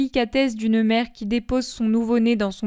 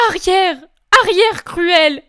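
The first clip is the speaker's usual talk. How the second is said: louder anguished cry at an unseen enemy; tearful shout of terror, mildly raised; 9 semitones higher, 8 dB louder than usual. Acted. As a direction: drive the vision back with a cry. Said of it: "Et elle crie".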